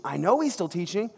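hungry disciple pleading with friends, rising desperation; mock desperate outburst. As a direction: burst out in hammed-up panic. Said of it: "Peter retold".

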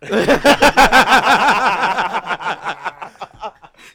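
long, loud, high cackling laugh; big laugh. Real, not acted. Podcast guest cracks up at the coffee joke, big laugh. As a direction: burst out laughing and keep it rolling.

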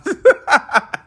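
loud high laugh. Real, not acted. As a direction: crack up.

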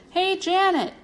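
high, insistent call. A narrator's reading. Ajax to Janet from the ring, insistent calling. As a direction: call for her attention from afar. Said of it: "Ajax called again".

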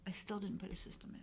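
sheepish whispered confession. Real, not acted. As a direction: whisper it like a guilty secret.

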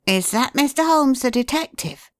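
put-on high eager boy's question; the lad asks, high and keen. A narrator's reading. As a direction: son asking father, high. Boy asks.

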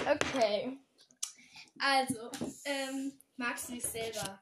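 loud over headphones; talking loudly over headphones; too loud. Real, not acted. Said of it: "Du redest zu laut".

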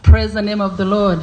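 ordinary delivery

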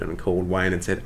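normal talking voice

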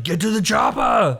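mock Arnold shout in an Austrian accent; Schwarzenegger impression. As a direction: bark the order like Arnold. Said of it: "Schwarzenegger, he'll be like".

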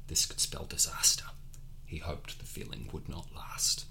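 hushed story whisper. A narrator's reading. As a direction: whisper it darkly.

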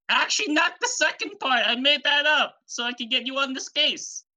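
high-pitched cartoon confession; high, hammed-up admission; Fisherman Joe. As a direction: backtrack in a high, excitable character voice. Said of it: "And he was like".

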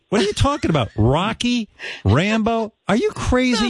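high disbelieving outburst; incredulous. Real, not acted. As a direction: pitch jumps up in disbelief. Incredulous at Stallone jab.

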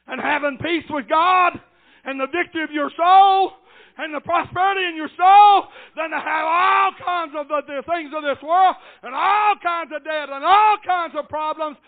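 loud, high preacher's shout, leaning on the last words; full-force preaching. Real, not acted. Preacher urging church on peace with God, full-force preaching. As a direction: drive each phrase home loudly.

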